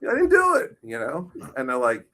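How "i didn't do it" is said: very high mock whine; whiny protest. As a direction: squeal the protest. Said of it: "I was like".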